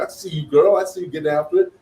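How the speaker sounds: high, loud cheer-on to a gym acquaintance; sassy, upbeat hype voice; hype-up encouragement. Retold or imitated. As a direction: cheer her on, pitched well up. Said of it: "that's how I would ... talk to her".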